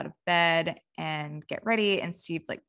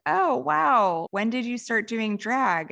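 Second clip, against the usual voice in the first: higher, lifted "oh, wow" of surprise; mild retold surprise; 5 semitones higher, 4 dB louder, slower than usual. [name] finding her ex now does drag, mock surprise. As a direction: imitate being pleasantly taken aback.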